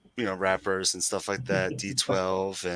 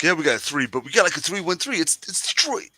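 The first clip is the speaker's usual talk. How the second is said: put-on high, loud Brooklyn accent; 8 semitones higher, 3 dB louder, faster than usual. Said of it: "my Brooklyn accent".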